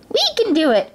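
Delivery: high, chirpy mouse rally; eager mice. A narrator's reading. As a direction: pipe up like determined little mice. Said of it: "cried the mice".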